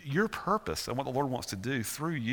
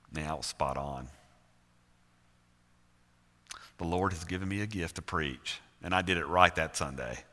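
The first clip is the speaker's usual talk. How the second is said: self-satisfied; 7 semitones lower than usual. Retold or imitated. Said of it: "there's a tendency to go".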